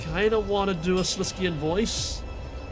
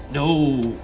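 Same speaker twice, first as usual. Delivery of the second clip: low dark voice; deep sinister voice; 5 semitones lower, 4 dB louder than usual. Acted. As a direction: growl it low. Second shadow voice.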